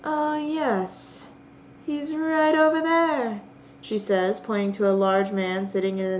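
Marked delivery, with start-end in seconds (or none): 0.0-3.4 higher and slow, drawn-out answer; speaks slowly. Acted. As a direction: point him out at an unhurried pace. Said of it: "then speaks slowly".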